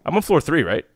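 ordinary talk, nothing marked

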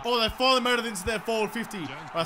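ordinary talk

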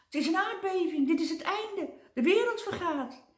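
high, loud panicky cries of a timid man; terrified wail. Acted. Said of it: "bibberend van angst".

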